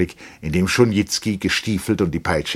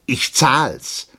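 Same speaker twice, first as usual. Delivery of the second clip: louder, higher, stubborn retort; insistent; 8 semitones higher, 6 dB louder than usual. A narrator's reading. Captain refuses the gift, loudly insistent. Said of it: "beharrte der Hauptmann".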